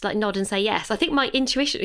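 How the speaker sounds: ordinary talk, nothing marked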